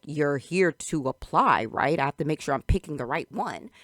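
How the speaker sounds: loud, emphatic condescension; condescending. Retold or imitated. Patrick to the supposed job applicant, condescending. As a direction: lay it out loudly as the boss. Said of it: "this very condescending voice".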